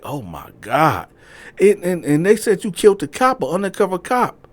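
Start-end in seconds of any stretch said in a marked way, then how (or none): 0.0-1.1 slow, stunned, dropping; man realizing what he did, slow; dismayed oh my god. Retold or imitated. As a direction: let it sink in slowly. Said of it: "He like".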